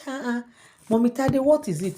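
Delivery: put-on high child voice, querying; acted girlish bewilderment; puzzled little girl. Child questions the queen in story.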